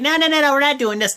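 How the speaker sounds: high, loud shouting no's; mock angry refusal. GM refusing the wrestlers' demand, mock shouting. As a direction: shut them down with a hammed-up yell. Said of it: "And then he's like".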